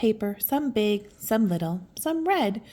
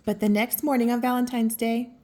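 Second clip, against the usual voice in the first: ordinary delivery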